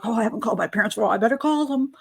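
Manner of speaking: rattled off very fast; rushed afterthought. Retold or imitated. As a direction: gabble it as a hurried duty call. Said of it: "you know, like".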